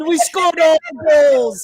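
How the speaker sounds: loud, high mock Jamaican; exaggerated Jamaican imitation, shouted out; mock Jamaican accent. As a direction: tease with a hammed-up Jamaican accent. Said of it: "Why are you Jamaican?".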